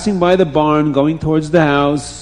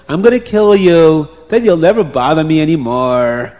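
threatening; 3 semitones higher, 8 dB louder than usual. A narrator's reading. Farmer threatening to kill the tied-up man, menacing. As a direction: threaten him at raised pitch and volume.

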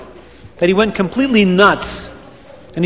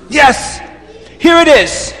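high, triumphant shout; excited shout; 6 semitones higher, slower than usual. Acted. Reb Chaim shouts his idea to Reb Beryl, excited and high. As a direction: cry it out like a man struck by an idea.

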